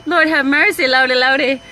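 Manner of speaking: laughing Lord have mercy. Real, not acted. Laughing at her office memory with viewers, amused. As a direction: crack up and sigh it out.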